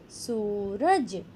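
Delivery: very slow, each sound held; slow drawn-out word. Shown as a demonstration. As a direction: sound it out for young pupils. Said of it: "spelling drill, slow blended word".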